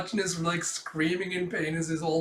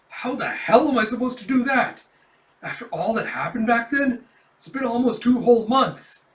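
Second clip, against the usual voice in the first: higher, louder flustered complaint; hammed-up worried outburst; panicked rant; 7 semitones higher, 7 dB louder than usual. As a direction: spiral into anxious panic. Kafka panics over messaging squad.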